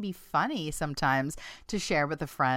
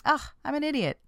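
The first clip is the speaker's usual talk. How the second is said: casual, higher-pitched 'ugh' at yourself; light, offhand self-put-down; harmless-sounding self-scolding; 8 semitones higher, faster than usual. Acted. Muttering at herself over a small mistake, seemingly harmless. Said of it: "in a seemingly harmless tone".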